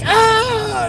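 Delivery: very high, loud, drawn-out mock scream; imitated frightened scream. Customer startled by delivery driver, mock high scream. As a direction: imitate a customer's terrified shriek. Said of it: "She's like".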